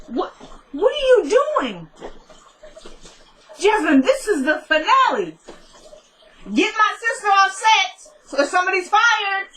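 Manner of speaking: high, indignant squeal at an interrupter; mock outraged outburst. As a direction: play the exasperated star scolding someone on set. Mock on-set outburst at Jasmine.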